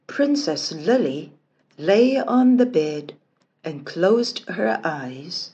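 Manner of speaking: ordinary delivery, nothing marked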